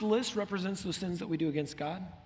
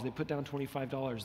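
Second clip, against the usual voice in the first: normal talking voice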